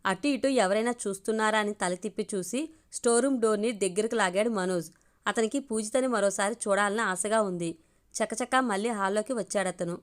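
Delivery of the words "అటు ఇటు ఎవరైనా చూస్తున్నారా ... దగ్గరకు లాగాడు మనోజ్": ordinary delivery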